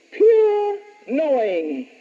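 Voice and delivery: slow, "pure" drawn out; very slow dictation, stretch "pure"; dictating. Shown as a demonstration. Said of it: "Number two".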